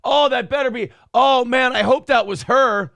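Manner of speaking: retold eager waiting for his wife's car; hopeful excitement. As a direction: call out each car hopefully. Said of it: "I'm like".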